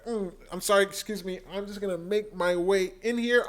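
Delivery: ordinary delivery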